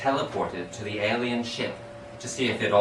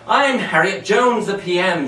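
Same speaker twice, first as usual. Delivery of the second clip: yelled; 8 semitones higher, 5 dB louder than usual. Acted. The PM yelling her own name, high and proud. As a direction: announce yourself to the whole room.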